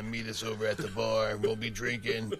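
lightly put-on smoker's radio voice; a hint of a crackly bar-promo DJ; scraggly smoker DJ. Shown as a demonstration. Host mocking smoker DJs inviting listeners to a bar, lightly scraggly. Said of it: "deep, scraggly smoker voices".

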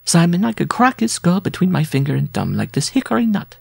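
high-pitched bride voice; high, scornful sweetheart; boastful bride. A narrator's reading. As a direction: boast to your lover in a high, put-on female voice. Bride boasting to her lover.

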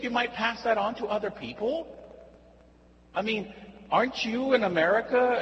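high-pitched scandalised friend lecturing. Retold or imitated. Friend lectures, much higher.

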